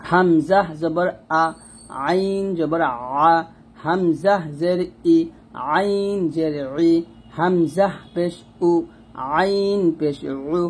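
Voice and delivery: slow drilled Arabic letter recitation. Shown as a demonstration. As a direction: spell each vowel out slowly like a teacher. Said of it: "এরকমভাবে পড়তে হবে". (ধীরে ধীরে হরফ উচ্চারণ)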